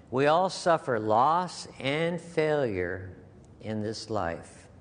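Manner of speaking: slow and grave; solemn slow preaching; slow, weighty. Real, not acted. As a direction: let each word land slowly.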